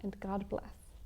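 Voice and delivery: soft, hushed goodnight whisper. A narrator's reading. Papa whispering goodnight to sleeping cubs, hushed. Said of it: "Papa whispers".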